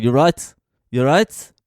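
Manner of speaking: mock the little thug squaring up; aggressive roadman. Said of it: "the more aggressive one".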